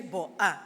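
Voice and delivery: "bo-a" as two separate sounds, b then ah; sounded-out syllable. Shown as a demonstration. Teacher showing how sounds form a syllable, sounded out. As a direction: split "bo-a" into its letter sounds. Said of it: "A syllable, it can be".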